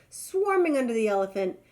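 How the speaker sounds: plain reading voice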